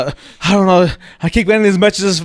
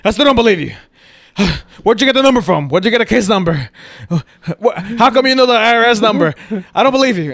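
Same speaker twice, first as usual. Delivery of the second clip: higher, fast rattled questions; panicked disbelief; 5 semitones higher, faster than usual. Retold or imitated.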